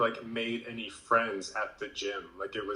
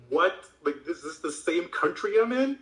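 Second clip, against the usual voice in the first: higher, louder incredulous question; retold disbelief; 5 semitones higher, 3 dB louder than usual. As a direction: replay your own astonished reaction. Retelling own disbelief at friendliness.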